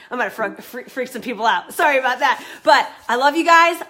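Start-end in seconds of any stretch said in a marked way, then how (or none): 1.7-3.8 louder, laughing sorry; laughing apology. Real, not acted. Coach laughing, apologizing to viewers for getting loud. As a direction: laugh it off and say love you.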